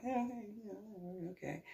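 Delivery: tiny high, very soft voice; quiet sceptical aside. Retold or imitated. Homeowner reacting to Mike's claim, in a small aside. Her aside while men talk.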